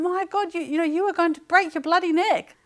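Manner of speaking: high, loud telling-off; loud, high-pitched alarmed scolding; frightened scolding. Retold or imitated. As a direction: scold him out of sheer fright. Mother scolding son after fall.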